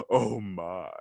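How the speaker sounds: a light take on the famous drawn-out catchphrase; a lightly voiced Star Trek helmsman, mock scandalized; lightly voiced starship officer, mock shocked; Captain Sulu impression. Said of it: "sound like Captain Sulu".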